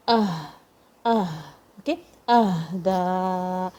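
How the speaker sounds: "Ah" breathed out like a tired sigh; deep sigh sound. Shown as a demonstration. Teacher showing a sukoon on ha, sighed h in "Ahda". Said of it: "sound like a deep sigh".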